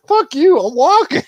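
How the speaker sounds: high, loud brush-off; mock defiant yell. Rey telling Palpatine off, mock loud. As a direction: shout it and storm out, hammed up.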